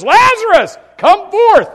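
a huge, high command shouted at the tomb; Jesus calling out. Acted. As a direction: boom it out like calling a dead man from the grave. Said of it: "Jesus says".

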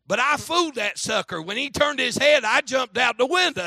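high, loud imitation of a boasting kid; triumphant boy. Willie tells how he fooled the devil, loudly triumphant. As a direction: crow it excitedly as a boy's punchline.

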